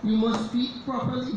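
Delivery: put-on a parent correcting a child's patois; a lightly voiced strict parent; scolding parent. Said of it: "they will try to say".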